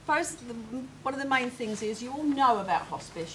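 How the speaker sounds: usual speaking voice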